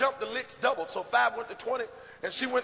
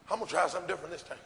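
quick scheming thought; 5 semitones lower, 3 dB quieter, much faster than usual. Retold or imitated.

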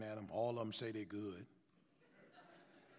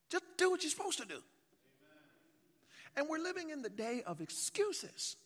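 whooping preacher; 11 semitones higher, 5 dB louder than usual. Acted. Preaching climax.

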